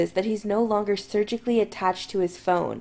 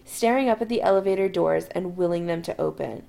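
ordinary delivery

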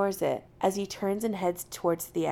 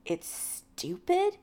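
slow, high questioning echo; icy; 10 semitones higher, much slower than usual. A narrator's reading. Jim challenging McCoy's dismissal of them, icily. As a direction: throw it back slowly, icily offended. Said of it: "he says icily".

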